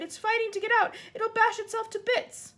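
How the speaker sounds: high and loud alarm; it's about to break free; frightened. Acted. Sophie frightened by thrashing dream.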